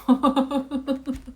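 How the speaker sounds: high, loud run of chuckles; loud laugh. Real, not acted. Reader laughs after joking about being far away, loud giggling laugh. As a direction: burst out laughing at your own remark. Laughs at own remark on distance.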